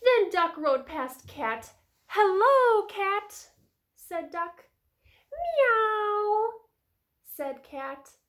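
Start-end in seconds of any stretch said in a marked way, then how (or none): 2.1-3.5 high, loud, drawn-out greeting; Duck calling hello. A narrator's reading. Duck greets Cat in story. 5.3-6.5 long, high imitated meow; cat meow. A narrator's reading. Cat noise in story.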